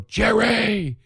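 high, drawn-out fan's call; high-pitched holler to a star. Retold or imitated. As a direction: call his name out in a high excited voice. Calls out to star in car.

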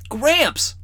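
high excited call to grandpa; boy crying out. A narrator's reading. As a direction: shout for grandpa's attention. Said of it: "Rawl cried out".

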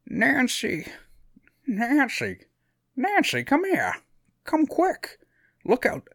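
lightly voiced old person calling out; high, thin elderly voice calling for Nancy. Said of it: "I wanna talk like an old person".

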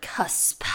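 "cusp" whispered slowly, savored; whispered word. Real, not acted. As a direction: breathe "cusp" with relish.

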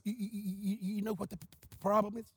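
soft imitated stammer; Brother Buddy's stutter. Stuttering evangelist starts to explain the problem, soft stammer. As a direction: get stuck on the first word, quietly. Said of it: "he stuttered".

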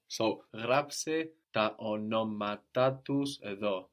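γράψε τα ονόματά τους εδώ slow, with the accent on ονόματά's last syllable too; slow model. Shown as a demonstration. Teacher repeating the Greek model slowly. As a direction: say it slowly, showing both accents. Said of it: "two accents".

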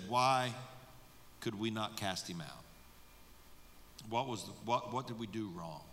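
quiet, sheepish question; embarrassed disciples. Acted. Disciples asking Jesus privately, sheepish and quiet. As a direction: ask it low, head down. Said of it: "They don't want to be embarrassed".

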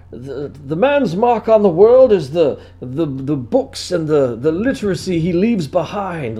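a slow solemn literary patriarch; grand literary grandfather. Retold or imitated. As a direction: hold forth like a great author. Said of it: "who's just like".

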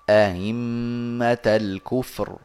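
أئمة الكفر with the second hamza eased, no alif inserted, drawn out slowly; slow demo of أئمة with softened second hamza. Shown as a demonstration. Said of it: "أهل سما يسهلون الثانية من غير إدخال". (تسهيل الهمزة الثانية في أئمة ببطء)

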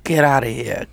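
ordinary talk, nothing marked

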